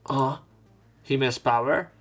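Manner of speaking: normal talking voice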